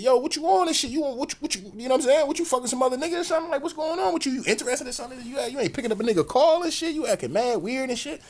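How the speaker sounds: high, fast, heated accusing rant; going off. Retold or imitated. Going off on his girlfriend, a high heated rant. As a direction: tear into her with questions, pitch way up. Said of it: "I'm going off".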